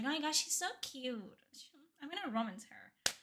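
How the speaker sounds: soft, high, lightly gushing fangirl; hint of a swooning girly fan. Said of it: "the girly pops".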